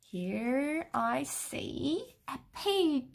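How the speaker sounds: usual speaking voice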